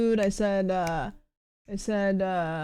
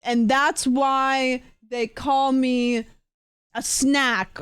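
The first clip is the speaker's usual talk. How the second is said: drawn-out punchline, very slow; 2 semitones higher, much slower than usual. Retold or imitated. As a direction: stretch every word out slowly. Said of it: "and then I said".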